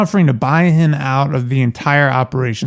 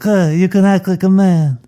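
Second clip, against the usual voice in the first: semi-retired Don; 6 semitones higher than usual. Acted. Cohost imitates the Don scolding Johnny, hammed-up. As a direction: growl it out like the old Godfather. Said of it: "the old semi-retired Don".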